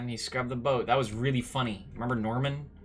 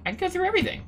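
amazed discovery; 12 semitones higher, much faster than usual. Real, not acted. Gamer finding he can go through everything, amazed. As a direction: voice shoots up high and fast.

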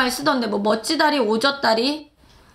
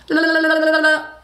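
fast la-la trill; tongue warm-up la-la; 4 semitones higher, faster than usual. Shown as a demonstration. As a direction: loosen the tongue with lalala. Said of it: "혀를 한번 풀고".

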